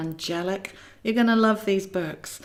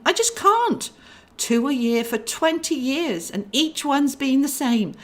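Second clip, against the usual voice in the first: higher, pleading and fretful; anxious angel; 5 semitones higher than usual. Acted. Clarabelle tells Clarence of her review, higher and anxious. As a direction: beg to be understood.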